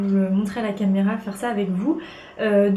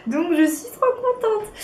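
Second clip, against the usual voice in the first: excited; 11 semitones higher than usual. Real, not acted. Thrilled about model agency contract.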